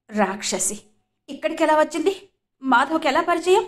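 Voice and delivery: seething, gritted-teeth anger; through gritted teeth. A narrator's reading. Vasu fuming after Jyoti leaves, gritted teeth. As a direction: spit it out through clenched teeth. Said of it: "పళ్ళు బిగిస్తూ అన్నాడు వాసు".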